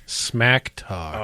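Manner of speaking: SMACK TALK set off as if in quotes; air-quote emphasis. Real, not acted. Co-host complaining about a rival's tweets, air-quote emphasis. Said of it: "You're doing air quotes every time".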